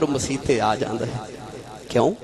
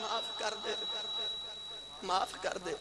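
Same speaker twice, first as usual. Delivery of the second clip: very soft, higher-pitched begging; hushed plea; 6 semitones higher, 17 dB quieter than usual. Acted. Sinner begging God to forgive him, hushed plea. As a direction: drop almost to nothing and beg.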